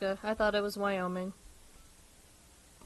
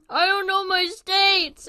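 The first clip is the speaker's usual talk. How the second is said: laughing; 9 semitones higher, 7 dB louder, slower than usual. Real, not acted. Laughing confession.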